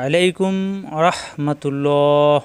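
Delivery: slow, chant-like salam with long held vowels; intoned Islamic greeting, very slow; drawn-out greeting. Real, not acted. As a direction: stretch out the greeting like recitation.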